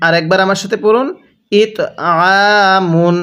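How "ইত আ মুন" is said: "আ" squeezed as Arabic ain and held long; pressed ain. Shown as a demonstration. Teacher leading learners to read along, "আ" a pressed, drawn-out ain. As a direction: say "ইত আ মুন", pressing the throat on "আ". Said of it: "আইনটা চাপ দিয়ে পড়লে".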